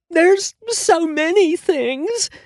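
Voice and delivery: high, slow, sob-broken words; through sobs. A narrator's reading. Ruined-hair client sobbing to her stylist, high and slow. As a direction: force it out between sobs. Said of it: "she managed to say in between sobs".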